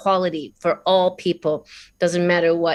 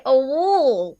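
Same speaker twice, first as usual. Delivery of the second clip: Australian "wall"; 6 semitones higher than usual. Retold or imitated. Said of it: "And I'd say".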